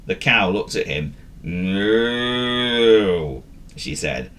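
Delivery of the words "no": long drawn-out moo of a word; mooing cow. Acted.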